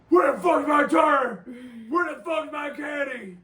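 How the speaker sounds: high, loud enraged bellow; furious screaming. Retold or imitated. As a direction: scream it like your cart was stolen. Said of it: "he was screaming it".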